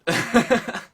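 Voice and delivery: loud, high burst of laughter. Real, not acted. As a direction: crack up loudly.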